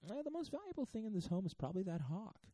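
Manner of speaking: high-pitched burglar weighing it up; imagined burglar. Acted. Burglar sizing up the hawk, high-pitched musing. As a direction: jump way up high, dropping back at the end. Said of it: "then I'd be like".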